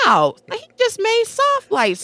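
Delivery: high, awed exclamation; amazed. Retold or imitated. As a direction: marvel at the simple trick.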